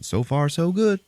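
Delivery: high, lilting call; joke's falling man. Retold or imitated. Man falling past each floor in a joke, high call. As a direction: pitch climbing, breezily optimistic.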